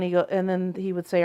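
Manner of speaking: normal talking voice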